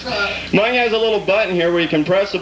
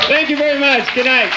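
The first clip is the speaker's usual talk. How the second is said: high mock sign-off; 10 semitones higher, 3 dB louder than usual. [name]-style sign-off, pitched up.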